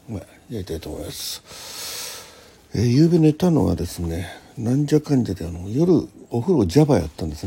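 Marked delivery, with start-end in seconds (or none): none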